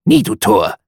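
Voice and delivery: loud, harsh raven refrain; croaking raven. A narrator's reading. Raven on the bust answers the narrator, croaking loudly. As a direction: croak the words like a bird. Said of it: "mit dem Krächzen".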